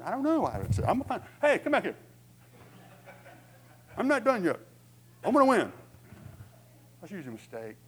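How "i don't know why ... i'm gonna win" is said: loud, high, defiant comeback; hammed-up refusal to lose; acted combative outburst. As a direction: charge back into the argument, voice raised. Acted inner quarrel, storming back.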